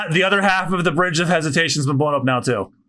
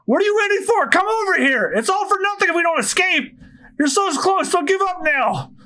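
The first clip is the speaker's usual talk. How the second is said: high-pitched urgent call; urgent yelling. A narrator's reading. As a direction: yell encouragement to a friend, pitched way up. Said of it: "Usopp starts yelling out to him again".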